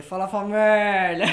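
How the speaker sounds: stretched, loud greeting call; catchphrase voice. Retold or imitated. Greeting the work crew with his stretched catchphrase. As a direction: belt out the greeting slow and drawn out. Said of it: "Mas essa vozinha".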